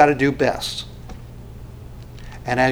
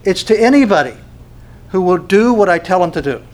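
louder and higher, Jesus stating it firmly; firm declaration; 4 semitones higher, 5 dB louder than usual. Retold or imitated. Preacher voicing Jesus correcting the crowd, louder and higher. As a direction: ring it out with authority, higher and louder. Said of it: "and Jesus goes".

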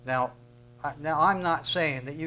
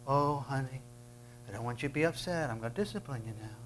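mock soft parent; 8 dB quieter than usual. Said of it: "mocking soft permissive parent".

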